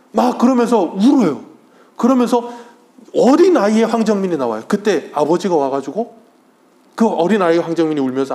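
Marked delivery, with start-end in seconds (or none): 0.1-4.6 emotional. Real, not acted. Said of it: "막 눈물이 날 것 같더라고".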